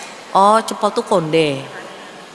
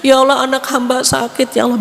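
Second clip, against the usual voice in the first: mock tearful plea; 5 semitones higher, 3 dB louder, faster than usual. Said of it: "Bisa nangis kita".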